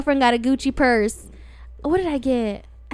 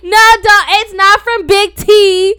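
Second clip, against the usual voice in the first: higher, louder, slow pleading no; loud denial; 8 semitones higher, 10 dB louder, slower than usual. Real, not acted. Denying the bag is fake, loud and pleading. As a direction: protest loudly, drawing it out.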